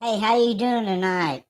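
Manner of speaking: ordinary delivery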